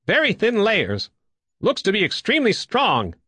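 high, keen observation; sudden remark. A narrator's reading. Woodbine examining the hull, high and sudden. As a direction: report the find with lifted pitch. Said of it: "he said suddenly".

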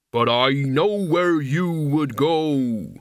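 slow, soft threat; soft menace. A narrator's reading. Mort threatening Albert, slow and soft. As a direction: let each word land slowly. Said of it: "said Mort softly".

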